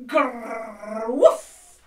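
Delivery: playful dog noises; dog growl and woof. Shown as a demonstration. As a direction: growl then a loud bark. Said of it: "I want you to say".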